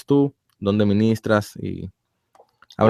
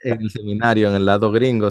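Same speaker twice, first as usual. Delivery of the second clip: ordinary delivery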